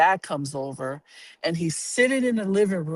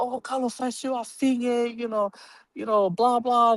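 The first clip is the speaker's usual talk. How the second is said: mild teary dad; 6 semitones higher than usual. Retold or imitated. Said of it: "then my dad started crying".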